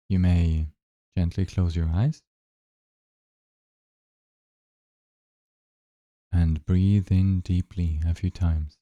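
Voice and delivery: unhurried, even-toned guidance, leaving space between phrases; guided meditation. Acted. Guide asking listeners to notice their breath, calm guided meditation.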